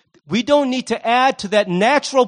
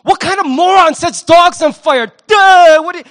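higher, much louder scornful yell; mocking shout of disdain; contemptuous yell; 9 semitones higher, 8 dB louder than usual. Shown as a demonstration. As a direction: bark it at them like they're a moron. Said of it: "you don't have to say it like".

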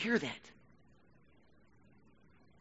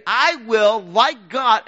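mock proud claim; 8 semitones higher, 14 dB louder, much slower than usual.

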